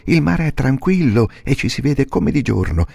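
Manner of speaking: molto alto, incalzante; burattino eccitato. Acted. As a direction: esorta il babbo. Pinocchio guida il babbo. (burattino che esorta il babbo, voce alta)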